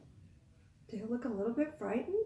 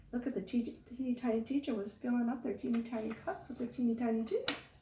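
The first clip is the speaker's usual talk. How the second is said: ordinary delivery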